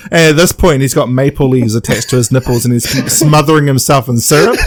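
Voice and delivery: ordinary delivery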